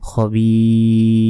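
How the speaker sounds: খবির chanted slowly, the final র silent-stopped; stopped with sukun. Shown as a demonstration. Teacher demonstrating the pause form খবির. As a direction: recite খবির long, closing with sukun. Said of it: "সাকিন দিয়ে উচ্চারণ করতে হবে".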